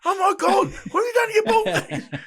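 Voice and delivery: high, loud squealing women laughing; shocked women. Retold or imitated. Women at the bar react to his injury, high laughing shriek. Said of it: "These women are going".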